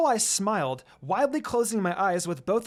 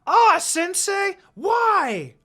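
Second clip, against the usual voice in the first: loud, high, drawn-out whine of protest; yelling; 14 semitones higher, 9 dB louder, much slower than usual. A narrator's reading. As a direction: Naruto yelling in protest, stretched out. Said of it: "Naruto burst out yelling".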